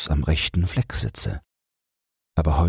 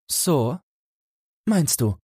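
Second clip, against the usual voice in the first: much higher, eager and indignant; eager retort; 12 semitones higher, slower than usual. Acted. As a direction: snap back eagerly. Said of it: "entgegnete Felix sehr eifrig".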